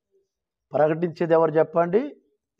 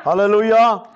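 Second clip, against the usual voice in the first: raised congregational hallelujah; loud hallelujah; 2 semitones higher, 4 dB louder, slower than usual. Real, not acted. Preacher leads the congregation in praise, loud hallelujah. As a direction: call it out for everyone to join. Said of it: "గట్టిగా హల్లెలూయా చెప్దాం".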